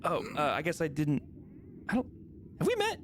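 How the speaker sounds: hesitant, awkward sergeant. Acted.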